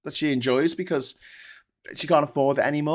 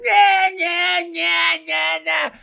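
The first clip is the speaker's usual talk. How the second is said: put-on high, loud, whining baby wail; hammed-up baby tantrum; mock crying baby; 13 semitones higher, 5 dB louder, much slower than usual. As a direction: bawl it like a spoilt infant on its back. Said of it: "on their backs going".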